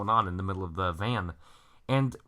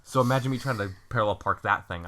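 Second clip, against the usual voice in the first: normal talking voice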